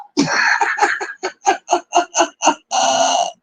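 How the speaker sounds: long, high helpless laugh; real uncontrollable laughter. Real, not acted. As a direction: let a long squealing laugh roll out. Laughing hard at memory.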